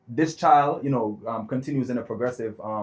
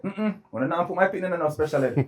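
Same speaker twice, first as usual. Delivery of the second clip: higher-pitched mock mother, firm and final; mother's firm refusal; 7 semitones higher than usual. Mother refusing the school's special ed plan, in a higher mock voice. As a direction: imitate mom shutting the idea down flat. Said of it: "My mother said".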